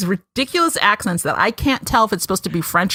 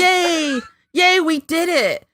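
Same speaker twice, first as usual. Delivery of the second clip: high-pitched mock celebration; mock cheering; 9 semitones higher, slower than usual. Host voicing the band's silly triumph, mock cheering.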